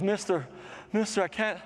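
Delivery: high, pleading mock-tearful voice; imitating tearful student. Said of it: "comes up to me and she's crying".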